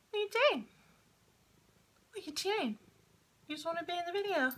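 high sing-song voice for a cat; cooing pet talk. Real, not acted. Owner teases cat wanting into the video, cooing pet talk. Talking to her cat Stitches.

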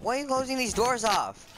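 high-pitched indignant complaint; worked-up, high-voiced objection; alarmed protest. Real, not acted. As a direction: raise the pitch in exasperated protest. Protests teammates closing doors.

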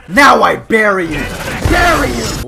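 loud, menacing mock threat; battle roar. Fighter about to bury his opponent, loud roar. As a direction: roar it as a finishing move.

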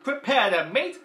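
high, loud, slow menacing threat; Mister Potato Head voice. Retold or imitated. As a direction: threaten like a toy villain. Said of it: "he went like this".

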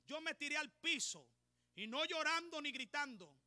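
ordinary talk, nothing marked

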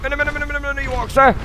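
high, rattled-off string of pleas; urgent urging. Real, not acted. Player fleeing and urging on, high frantic chant.

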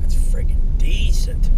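slow, breathy hush of admiration; a slow, hushed "decent"; whispered. Real, not acted. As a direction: hush it like you're awestruck. Admiring fancy seats, whispered.

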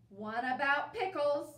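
loud, demanding bark; commanding. Acted. Bear demands a pickle book from Mouse, loud and bossy. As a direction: order it like a grumpy bear. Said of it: "commanded Bear".

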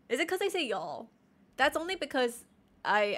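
ordinary talk

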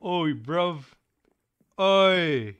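high, hollered British street call; mock British accent. Streamer teasing co-streamer, shouting in mock British accent. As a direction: belt it out like a cartoon Brit. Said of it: "I'm British now".

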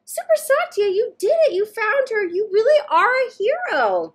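put-on thrilled little boy cheering his friend; excited child. Jahan cheering Satya for finding his T-Rex, thrilled and loud. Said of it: "Jahan said".

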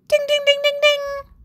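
high ringing ding-dings; bell imitation. A narrator's reading. Narrator imitates the end-of-school bell ringing.

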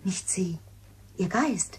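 hushed, voiceless whisper. Acted. Maid whispering about the bride's ghost, hushed whisper. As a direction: breathe it like a secret.

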